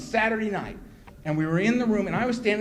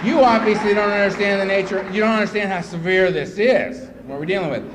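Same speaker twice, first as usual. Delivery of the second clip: loud, forceful lecture; emphatic retold reprimand; stern scolding; 3 semitones higher, 9 dB louder than usual. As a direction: lay down the law loudly. Acted scolding by sponsor.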